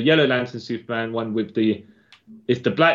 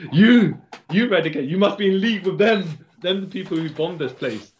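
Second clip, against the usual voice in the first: villain voice; 5 semitones higher than usual. Retold or imitated. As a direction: accuse him like a comic villain. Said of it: "Cyborg Superman says".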